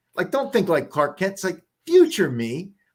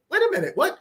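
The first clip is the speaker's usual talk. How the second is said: high, baffled mock double take; confused double take; 12 semitones higher, faster than usual. Mocking confused readers with a high double take. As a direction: jump up high like you just lost the thread. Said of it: "they're like".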